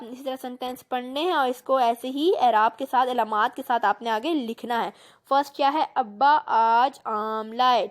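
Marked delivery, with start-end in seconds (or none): 5.9-7.9 slow model reading. Shown as a demonstration. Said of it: "اس کو پڑھنا ہے".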